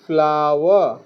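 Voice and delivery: "ফ্লাওয়ার" ending in an ah-wa glide; teacher models "ফ্লাওয়ার" as flaa-wa, falling; "আওয়া" sound in a word. Shown as a demonstration. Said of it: "ও ডাব্লিউ এর উচ্চারণ হয়".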